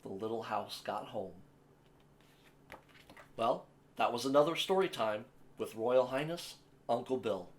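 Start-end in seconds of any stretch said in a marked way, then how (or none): none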